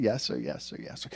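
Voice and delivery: put-on a boy mumbling obedience to his father; meek yes sir. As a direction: son answering his scolding dad, meek and soft. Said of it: "he said".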